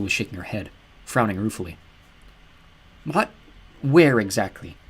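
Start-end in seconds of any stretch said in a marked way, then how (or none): none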